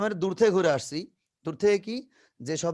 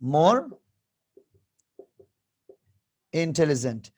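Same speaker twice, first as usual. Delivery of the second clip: more and intelligent set apart with long pauses; teacher spells out more intelligent slowly; slow emphatic; much slower than usual. Shown as a demonstration. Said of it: "more দিয়ে দিব".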